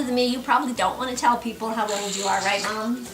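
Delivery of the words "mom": usual speaking voice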